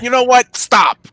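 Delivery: loud, high mock outburst; exasperated put-a-stop-to-it shout; retold exasperation. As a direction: act out cutting someone off, shouting the last word. Retold snapping at his wife.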